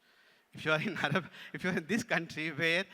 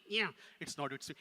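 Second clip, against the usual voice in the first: ordinary talk, nothing marked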